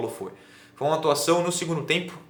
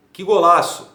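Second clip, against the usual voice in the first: loud imitation of a TV goal call; explosive goal commentary; 4 semitones higher, 8 dB louder, slower than usual. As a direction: yell it like a commentator. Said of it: "e que golaço, falou Lucas Pereira".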